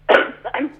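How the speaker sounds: harsh throat clearing from a cold; dry cough. Real, not acted. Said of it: "Entschuldigung, kurz husten, ich bin so erkältet".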